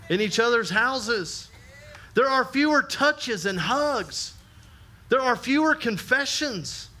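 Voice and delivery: rising, emphatic sermon cadence; preaching. Acted. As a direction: preach it to the congregation with fervor. Preacher exhorting church.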